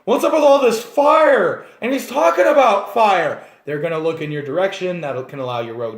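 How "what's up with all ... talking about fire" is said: high, slow, puzzled onlookers staring at the fire; baffled townsfolk. Retold or imitated. Townsfolk gawking at the flaming hair, high and bewildered. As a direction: gawk at the burning hair out loud. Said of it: "They're all gonna be like".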